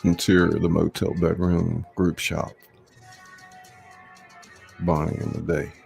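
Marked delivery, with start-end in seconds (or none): none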